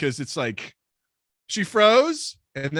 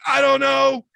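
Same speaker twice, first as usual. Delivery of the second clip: higher, louder bewildered whine; dazed reply; 10 semitones higher, 7 dB louder than usual. Retold or imitated. Narrator answering stunned friend, high and bewildered. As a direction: answer helplessly, voice jumping up. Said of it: "I'm like".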